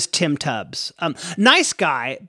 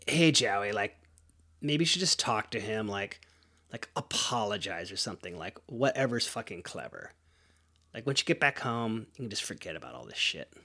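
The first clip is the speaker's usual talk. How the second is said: much softer and lower, musing to himself; inner self-talk; 7 semitones lower, 11 dB quieter than usual. Retold or imitated. Teen talking himself into calling Dad, hushed inner voice. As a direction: reason quietly with yourself.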